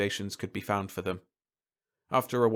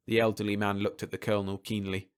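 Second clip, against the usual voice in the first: usual speaking voice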